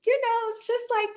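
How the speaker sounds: prim high lady voice; ladylike voice. Shown as a demonstration. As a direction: show them how a proper lady sounds. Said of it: "speak like a lady".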